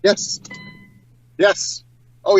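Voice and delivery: usual speaking voice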